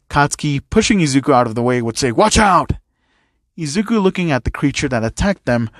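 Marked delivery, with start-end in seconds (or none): 2.1-2.7 higher and louder alarm call; warning shout. A narrator's reading. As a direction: yell to push him clear.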